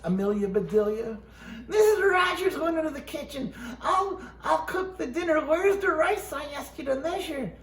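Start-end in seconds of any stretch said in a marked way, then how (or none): 1.7-7.5 pitched high and fretful; high upset voice. Acted. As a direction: read it high and flustered. Reading in raised pitch, upset.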